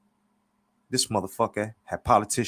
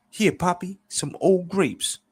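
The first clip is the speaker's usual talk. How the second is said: high, slow, playful offering; high-pitched smooth talker; Clever; 11 semitones higher, 3 dB louder, slower than usual. A narrator's reading. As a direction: present a gift in a high, drawn-out voice. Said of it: "Clever then says".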